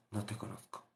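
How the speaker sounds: low, almost faint final verdict; the Lord's very soft, low last word; very quiet rejection. Acted. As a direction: drop it down to nothing.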